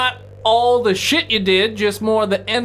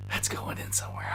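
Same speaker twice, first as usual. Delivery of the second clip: whisper; 15 dB quieter than usual. Real, not acted. Aside to the table.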